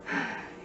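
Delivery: soft, audible breath out; demonstrated sigh. Shown as a demonstration. Sign teacher showing lungs expand and contract, mock sigh. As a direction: breathe out a bored sigh for show. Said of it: "you might sigh".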